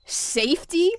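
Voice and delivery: loud, high scornful yell. A narrator's reading. Tom scorning his mate's worry, a loud yell. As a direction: throw the word back at her. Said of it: "he yelled out".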